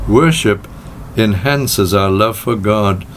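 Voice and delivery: usual speaking voice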